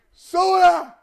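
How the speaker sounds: high, loud imitated scream at a vending machine. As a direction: yell it at the machine, hammed-up. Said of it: "you gotta scream at it".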